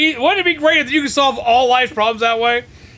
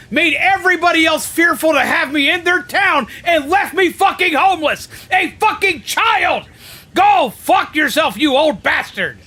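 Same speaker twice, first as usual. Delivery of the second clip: furious rant; 7 semitones higher than usual. Real, not acted. Streamer venting at the commander, furious. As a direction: let the rage boil over.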